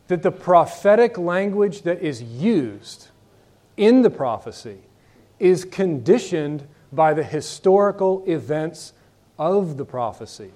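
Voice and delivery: slowed right down, each phrase weighted; slow and emphatic. Shown as a demonstration. Teacher spelling out the principle to the class, slow and emphatic. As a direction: lay it out slowly so the class catches it. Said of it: "The prophetic language that's used".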